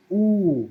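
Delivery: ऊ drawn out extra long, loud and falling; prolonged pluta vowel. Shown as a demonstration. Teacher showing students the pluta ऊ, prolonged. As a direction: hold ऊ for three beats. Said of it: "ऐसा इसका उच्चारण है".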